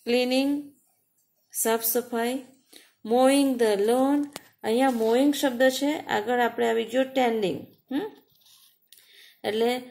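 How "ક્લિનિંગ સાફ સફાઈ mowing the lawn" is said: ordinary delivery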